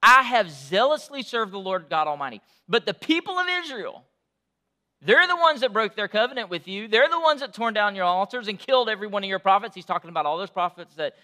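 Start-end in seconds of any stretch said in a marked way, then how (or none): 0.0-9.8 louder, pressing the complaint; a preacher voicing Elijah's frustrated protest. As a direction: pour out the grievance to God. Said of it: "Elijah replied".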